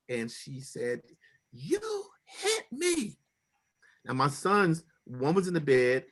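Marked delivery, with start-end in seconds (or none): 1.5-3.2 mock scream. Wife falsely accusing husband, retold high-pitched scream. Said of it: "and she screamed".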